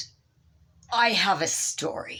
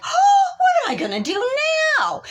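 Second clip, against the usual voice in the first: very high, loud wail; despairing shout; 5 dB louder, faster than usual. Retold or imitated. Stranded woman wailing on the roadside. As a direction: cry out in despair.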